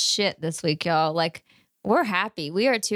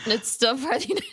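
higher, giggling; laughing; 6 semitones higher than usual. Real, not acted. Host laughing at Alaska still being US, giggling. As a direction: laugh mid-word in disbelief.

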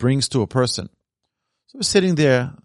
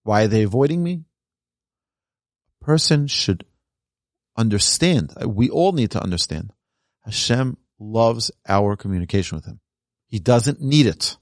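ordinary delivery

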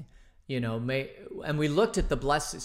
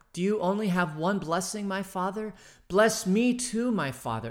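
desperate plea, higher and louder on bless me too; pleading cry; 7 semitones higher, 4 dB louder than usual. Acted. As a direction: son begging for a blessing. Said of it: "And then Esau wept aloud".

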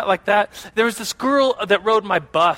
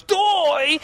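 mock scream; 9 semitones higher than usual. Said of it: "she was always screaming".

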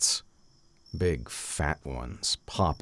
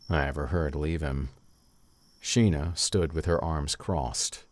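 ordinary delivery

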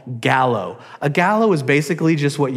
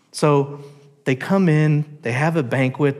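ordinary talk

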